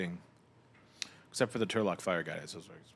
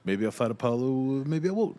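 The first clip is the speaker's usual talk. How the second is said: put-on boxer's Russian accent, raised pitch and volume; 5 semitones higher, 6 dB louder than usual. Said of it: "that was too Russian".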